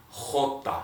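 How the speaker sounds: "J" as a breathy throat sound; throaty J. Shown as a demonstration. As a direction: model "J" with a throaty jota. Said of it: "repeat after me".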